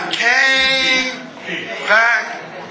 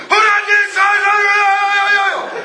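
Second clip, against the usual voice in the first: hammed-up shouting complaint; mock yelling; 7 semitones higher than usual. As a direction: play the angry one storming in. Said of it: "comes yelling and screaming".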